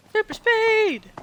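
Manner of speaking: high, loud hype shout; rally the team to hurry; hyped cheer. Pretend. Hyping a speed run.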